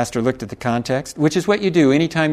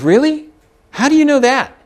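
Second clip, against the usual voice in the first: higher and louder, feigned wonder; mock surprise; 10 semitones higher, 5 dB louder than usual. Roofer feigning surprise to test the pastor, high and loud. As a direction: play innocent and wide-eyed.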